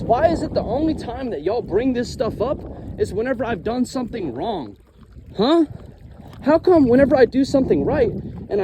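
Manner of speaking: heated, high-pitched venting; mad, emotional. Real, not acted. Gifted kid confronting those who only blame him, mad and emotional. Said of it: "I'm going to get mad in this video".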